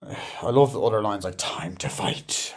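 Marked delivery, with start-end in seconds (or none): 1.4-2.5 very slow, low rasped whisper; imitated battle line. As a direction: drag it out low and soft like a challenge. Quoting a villain's line.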